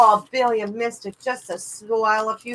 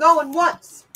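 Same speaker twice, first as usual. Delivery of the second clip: higher and louder auctioneer call; 8 semitones higher, 7 dB louder than usual. Real, not acted. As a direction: call it out to the room. Auctioneer calling the lot.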